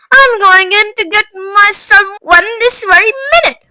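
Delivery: high, loud bratty tantrum shout; spoilt shout. Acted. Veruca shouts at her mother, bratty tantrum. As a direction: rattle the pets off in a spoilt rage. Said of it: "shouted Veruca".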